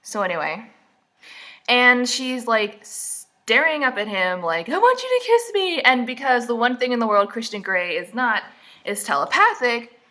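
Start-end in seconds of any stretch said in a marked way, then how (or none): 4.7-5.8 high-pitched pleading caricature; mock longing heroine. Heroine staring up at him, mock longing. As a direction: send up her yearning in a high voice.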